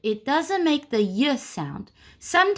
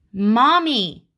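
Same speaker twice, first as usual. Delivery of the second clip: mock gasp; 3 semitones higher, 5 dB louder than usual. Gasps at picture of mommy.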